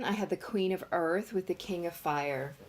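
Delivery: usual speaking voice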